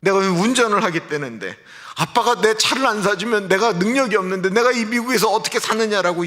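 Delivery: put-on high, upset kid protesting his dad; a child's indignant high-pitched complaint; angry child. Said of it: "막 아이가 화를 내면서". (화를 내며 아빠에게 따지는 아이)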